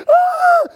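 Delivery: put-on a high, loud, shrieking scream like a small scared child; childish scream. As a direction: squeal in mock terror. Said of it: "like a small child going".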